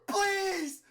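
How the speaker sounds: high, loud scream of pleading; horrified shout. A narrator's reading. Martlet begging as she melts, horrified shout. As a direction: beg at full scream. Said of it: "she shouts in horror".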